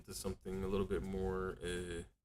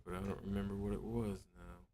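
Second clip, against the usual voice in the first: ordinary talk, nothing marked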